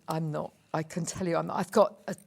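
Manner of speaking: ordinary talk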